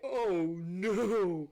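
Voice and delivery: dismayed. Retold or imitated. Smoke billowing from the oven.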